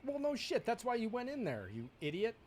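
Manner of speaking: loud exasperated yelling; angry. Real, not acted. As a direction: snap at him in frustration. Player yelling at game character.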